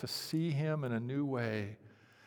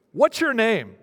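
much higher, loud startled burglar; startled shout; 10 semitones higher, 12 dB louder than usual. Acted. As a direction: blurt it out, spooked in the dark. Startled burglar in parrot joke.

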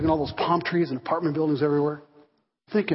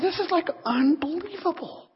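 higher, hushed amazed thought; inner amazement; 10 semitones higher, 3 dB quieter than usual. Retold or imitated. Pastor thinks to himself about the baker, hushed amazed thought. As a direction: marvel to yourself. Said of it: "I'm thinking".

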